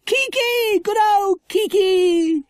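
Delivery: put-on loud, very high child's cheer; high, slow-stretched joyful yell; excited shout. A narrator's reading. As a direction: call out in delight, stretching the words. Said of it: "shouted Lucy Ann".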